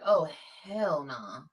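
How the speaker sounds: slow, drawn-out "Oh, hell nah"; mock refusal. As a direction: drag out the disgust at the heat. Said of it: "I was like".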